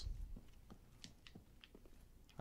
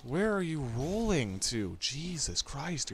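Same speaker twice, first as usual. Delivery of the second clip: exasperated disbelief; 6 semitones higher, slower than usual. Real, not acted. Enemy rolling away in fight.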